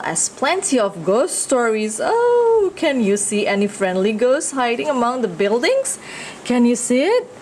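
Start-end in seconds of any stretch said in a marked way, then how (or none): none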